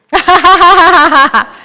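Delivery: loud, high burst of real laughter. Real, not acted. Caught out by Teresa, bursting into loud laughter.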